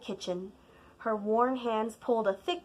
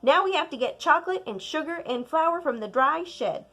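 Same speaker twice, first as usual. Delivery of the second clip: higher, louder grandmother; grandma instructing; 6 semitones higher, 6 dB louder, faster than usual. Acted. Grandma sending child to dry shed, louder. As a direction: direct the child onward.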